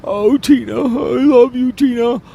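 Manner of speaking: fake-sob over the coffin; mock crying mourner. Podcaster mocking a mourner crying at Tina's grave, hammed-up wail.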